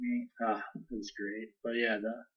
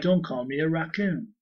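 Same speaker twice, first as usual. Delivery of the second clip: high, loud, slow Cockney; mock Cockney Rocket Raccoon; 5 semitones higher, 6 dB louder, slower than usual. Joining co-host's cartoon Rocket impression, mock Cockney. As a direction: play along with a hammed-up Cockney retort. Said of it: "He had a cockney".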